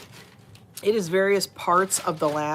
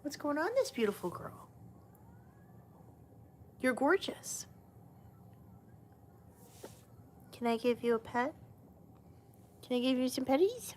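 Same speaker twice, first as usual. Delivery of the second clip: pet talk; 4 semitones higher, 8 dB quieter, faster than usual. Real, not acted. Cooing at a cat.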